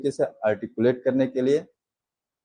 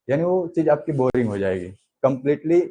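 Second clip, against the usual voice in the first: ordinary talk, nothing marked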